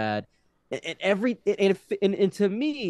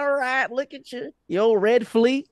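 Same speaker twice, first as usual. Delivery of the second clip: mock banter; 12 semitones higher, 6 dB louder, slower than usual. Voicing banter on reservation.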